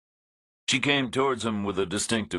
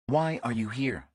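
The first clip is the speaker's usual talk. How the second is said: a dry, wary woman, a touch higher; woman's dry voice; 6 semitones higher than usual. A narrator's reading. Woman complains to Reacher about no signal, dry and higher.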